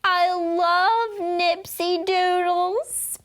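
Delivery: put-on high, slow eager child voice; drawn-out childish gush; eager kid. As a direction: gush it like a first grader. Lenny continues his eager declaration.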